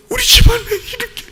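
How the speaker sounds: high, thin acted wailing; hammed-up sobbing lament; mock sorrow. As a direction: whimper in exaggerated sadness. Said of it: "이런 슬픔이 왔어".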